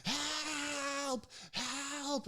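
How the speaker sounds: very high, stretched-out wails; hammed-up cries for help; imitated frantic cries. Old patient in the ambulance calls out, imitated high wailing. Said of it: "gets mad again".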